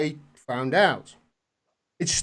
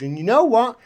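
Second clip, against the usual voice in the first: ordinary talk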